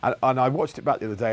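ordinary talk, nothing marked

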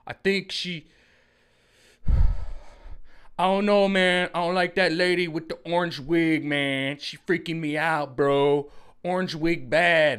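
freaked out, talking to a buddy; creeped-out bro. Retold or imitated. Woken-up guy complaining about Psaki, loud bro voice. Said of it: "like, Psaki".

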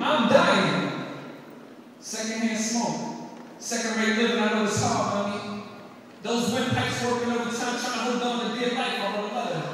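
rhythmic slam poetry delivery, building in bursts; spoken word poem. Acted. Son performing a poem for his mom, rhythmic and impassioned. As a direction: perform it like a slam poet pleading.

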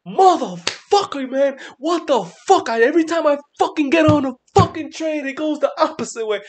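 high, loud mock rant; high-pitched mock rage at the chart; retold meltdown. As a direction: replay losing it over the trade. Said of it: "I was like".

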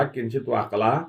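ordinary delivery, nothing marked

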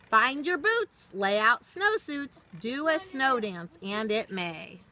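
lilting, rhythmic storybook read; reading to children. Acted. Teacher reading a rhyming book to children, storybook lilt. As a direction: read the rhyme slowly to little listeners, sing-song. Reads picture book aloud.

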